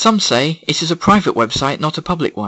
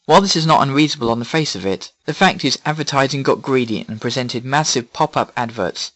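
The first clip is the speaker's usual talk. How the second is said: usual speaking voice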